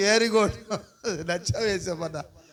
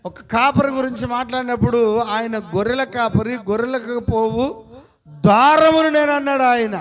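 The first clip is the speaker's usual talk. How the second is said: higher, louder, slowing on the climax; preaching at full force; 4 semitones higher, 4 dB louder than usual. Acted. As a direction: drive the point home to the church. (గట్టిగా ఉత్సాహంతో ప్రసంగం)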